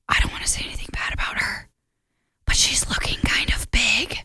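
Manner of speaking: hushed whisper so the cat won't hear. Retold or imitated. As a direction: whisper it like a guilty secret. Boyfriend confides about the cat.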